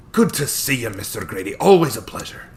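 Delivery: put-on old lawyer greeting in a light Scottish brogue; thick Scottish accent. Old attorney greets Owen warmly, light Scottish accent. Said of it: "he said with a thick Scottish accent".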